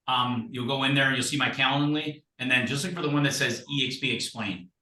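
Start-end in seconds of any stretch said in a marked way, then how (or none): none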